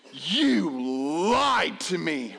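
slow, heavy mock accusation; spaced-out words of a betrayed child; angry. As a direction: accuse him slowly, word by word. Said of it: "of anger. He looks at me".

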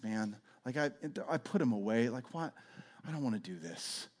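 tired, low, drained complaint; weary Peter. Retold or imitated. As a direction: sound worn out and done with it. Simon weary after failed night.

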